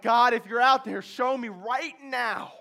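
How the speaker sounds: loud desperate plea to God; desperate cry. Retold or imitated. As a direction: beg God out loud, slowing at the end. Desperate prayer with gun ready.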